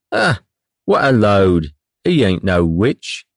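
slow, coarse sailor heckle; rough heckler. Acted. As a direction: jeer from the back. Heckler shouts from crowd.

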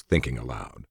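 ordinary delivery

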